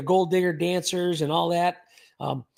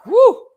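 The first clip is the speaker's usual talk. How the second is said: short, high, loud wolf "woo"; quick yelped howl; husky howl; 11 dB louder than usual. Retold or imitated. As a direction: imitate a howling husky. Imitating his part-wolf husky's howl.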